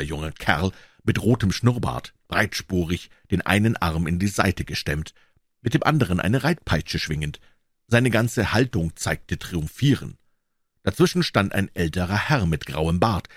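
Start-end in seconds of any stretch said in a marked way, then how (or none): none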